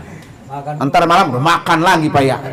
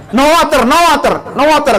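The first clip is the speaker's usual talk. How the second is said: mock guard; 9 semitones higher than usual. Guard blocking pilgrims' water, mock stern call. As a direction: bark it like the mosque guard stopping pilgrims. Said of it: "kata petugasnya".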